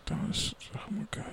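breathy whispered disbelief; exasperated whisper. Real, not acted. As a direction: whisper it, fed up. Exasperated about the movie.

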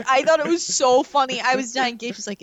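normal talking voice

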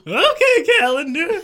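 very high, loud flustered reply; loud, extra-high character voice; smiling Johann. Acted. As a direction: squeal it in a put-on voice. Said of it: "smiled Johann".

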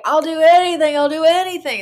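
high, loud pleading; imploring at full voice; retold desperate plea. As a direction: act out frantic bargaining. Retold desperate plea to child self.

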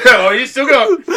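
high, loud, laughing call; laughing mock alarm. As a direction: crack up while acting surprised. Echoes the acted warning, laughing.

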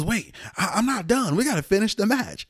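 lightly acted urgent insistence; insistent protest. Injured wrestler refusing to leave the match, insistent protest.

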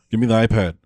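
loud demand in a put-on Boston accent; mock Boston accent. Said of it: "when you get the Boston accent".

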